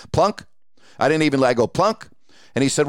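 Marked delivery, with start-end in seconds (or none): 0.1-0.5 high, rising cue to a co-host; questioning handoff. Retold or imitated. Host to analyst after a blowout, questioning handoff. 1.7-2.1 sharply rising, high-pitched name cue; questioning handoff. Retold or imitated. Host passing the blowout to his analyst, questioning handoff.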